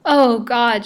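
a high, loud gasp of terror at the thought of death; terrified cry. Acted. Lily dangling over the hound, crying out in terror. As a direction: cry out to God in panic.

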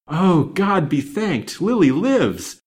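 high, loud, drawn-out cry of joy; ecstatic. A narrator's reading. Banker learns his daughter Lily lives, ecstatic. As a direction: cry out slowly in rapturous thanks. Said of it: "in a low voice of ecstasy".

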